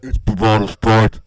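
low, loud, slow slogan in a put-on voice; alternative jingle take. Shown as a demonstration. Host trying another slogan version, low loud character voice. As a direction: drop down and push each word out heavily. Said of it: "What about this?".

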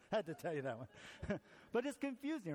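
usual speaking voice

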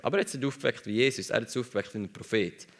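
ordinary talk, nothing marked

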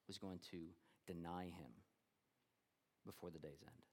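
preacher going hushed to land the hardest news; hushed and grave. Real, not acted. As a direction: let it sink in, almost under the breath. Preacher drops to hushed gravity.

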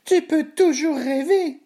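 very high, squeaky mocking voice; scoffing gingerbread man. A narrator's reading. Gingerbread man brushes off the cow, high scoffing. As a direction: scoff slowly in a tiny high voice. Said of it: "s'exclaffa le bonhomme de pain d'épices".

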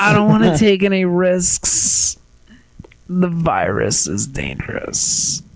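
imitating the date, slow and earnest; mock serious girl.

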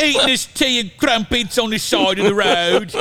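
high, exaggerated English accent; hammed-up English accent. Podcast host demonstrating the title, hammed-up English accent. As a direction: ham it up as a comic Englishman. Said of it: "English accent".

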